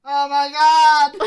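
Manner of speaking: loud slow mock gasp; exaggerated shock; shocked shout.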